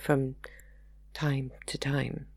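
slowing and fading away; trailing off. A narrator's reading. Margaret remembering her children, voice trailing off. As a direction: let the words drift off softly. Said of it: "Her voice trailed off at the end".

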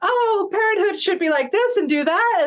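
high, sing-song mimicry of naive friends; mock know-it-all. Acted quote of know-it-all childless friends.

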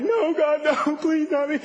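high-pitched, whimpering plea; mock crying. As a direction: imitate a tearful, panicked beg. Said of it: "like, crying. He's like".

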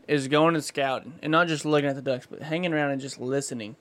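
ordinary talk, nothing marked

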